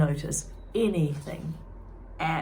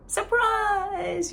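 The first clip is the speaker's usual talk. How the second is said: high, loud gleeful yell; spring the surprise on her; 5 dB louder than usual. Acted. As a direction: friends yelling surprise at June. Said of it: "yelled the Riveters".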